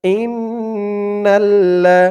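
slow, melodic tajweed recitation; slow Quranic chanting. Shown as a demonstration. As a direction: chant each word long and drawn out. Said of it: "লাম জবর লা".

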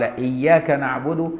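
إياك نعبد said without the leading waw; dropped waw. Shown as a demonstration. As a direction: start straight on إياك with no و before it. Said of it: "ما قالش".

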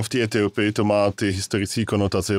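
ordinary delivery

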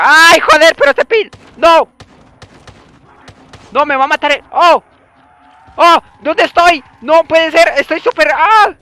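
loud high frantic shouts; real panic; panicked yelling. Real, not acted. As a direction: yell in desperation. Downed by zombies.

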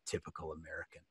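very soft, low aside; muttered judgement. Retold or imitated. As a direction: imitate listeners judging under their breath. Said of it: "you guys are like".

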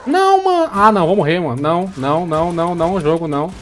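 high, loud panicked cries; panicking gamer. Real, not acted. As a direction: yelp as if about to die. About to die in game.

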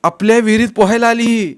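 high, indignant tattling; aggrieved, high-pitched accusation; loud complaint. A narrator's reading. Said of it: "वैभवने तक्रार केली".

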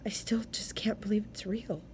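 soft whispered disbelief; despairing bewilderment. Acted. Wife to husband before the shelter, a hushed despairing whisper. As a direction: breathe it out in stunned despair. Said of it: "with a faint touch of despairing bewilderment".

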